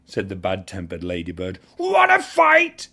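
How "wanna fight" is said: challenging shout. A narrator's reading. Ladybird picks a fight.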